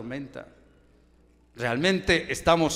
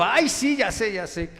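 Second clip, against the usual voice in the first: mocking brush-off; 7 semitones higher than usual. Jonah brushing off God mockingly.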